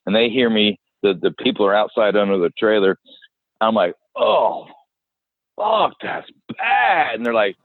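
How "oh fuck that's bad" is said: high, slow, drawn-out disgusted oh; high drawn-out mock revulsion; shocked disgust. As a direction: recoil from the stench, voice jumping up high. Smell of the dead animal.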